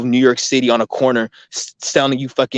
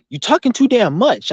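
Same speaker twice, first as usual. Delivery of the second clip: a higher mock of mom nagging; mom complaining; 10 semitones higher than usual. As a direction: lightly mimic mom's complaint. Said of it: "she's like".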